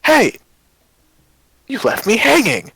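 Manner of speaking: loud, high, slow indignant call; indignant jilted call. Acted. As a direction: shout to him across the street. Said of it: "and go".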